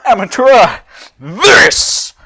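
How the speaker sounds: loud scornful roar; loud villain roar; roaring Cell. Acted. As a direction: roar it at him with contempt. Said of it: "he roars".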